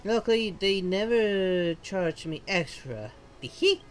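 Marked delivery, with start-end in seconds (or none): 3.4-3.8 high, loud cutesy giggle; playful teehee. Acted. Guide giggles after joking she was never charged, cutesy teehee. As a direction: giggle it out, cheeky and pleased.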